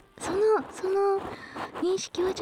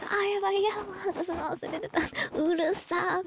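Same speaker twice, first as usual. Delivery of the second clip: quick, higher, panicked mock cry; startled yelp; 7 semitones higher, 3 dB quieter than usual. Voice actress reliving forgetting her earphones, startled mock cry. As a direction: blurt it out as if your ears just rang. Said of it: "みたいな".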